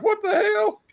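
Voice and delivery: squeaky shocked cry; high squeak. Retold or imitated. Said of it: "Bobby's like".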